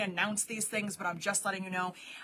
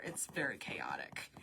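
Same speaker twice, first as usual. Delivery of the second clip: whisper; 14 semitones lower, 12 dB quieter than usual. Real, not acted. Aside while writing code.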